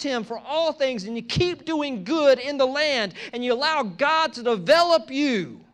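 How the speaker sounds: rising to a loud, high preaching shout; building preacher's cry. Acted. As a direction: build the sermon to a climax. Preaching builds to full force.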